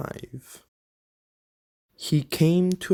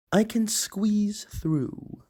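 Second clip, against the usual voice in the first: high and slow, squeezing each word out; a tiny car inching through a gap; determined little car; 8 semitones higher, slower than usual. Acted. Troto the little car, slowly and determinedly squeezing past.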